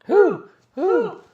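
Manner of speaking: loud, very high "who" yelps; short, high, hammed-up shouts; mock-outraged hooting. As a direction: echo the outrage like a hooting owl. Co-host joins repeated outraged who.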